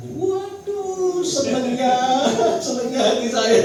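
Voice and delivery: high, loud gush of relief; delighted. Retold or imitated. Husband overjoyed at wife's apology, high and loud. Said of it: "Seneng, ya".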